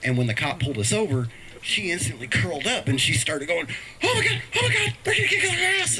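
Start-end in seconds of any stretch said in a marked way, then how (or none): 4.0-6.0 high, panicky mock squeal with a laugh; panicked daughter. Imitating his scared daughter during the traffic stop, high and panicky. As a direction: squeal it like a scared little girl.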